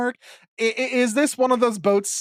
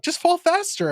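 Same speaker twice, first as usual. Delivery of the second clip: much higher, fussy Pearl voice; pitched-up mock Pearl; 13 semitones higher than usual. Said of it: "Pearl is like".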